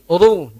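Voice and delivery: "Ru" pelan, ujung lidah ringan; slow light "Ru"; light ru demo. Shown as a demonstration. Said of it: "jangan r". ("Ru" ringan)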